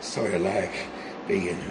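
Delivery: low, slow and soft, lingering on each word; dreamy murmur. Acted. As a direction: murmur it like stroking something precious. Caressing the bomb, dreamy.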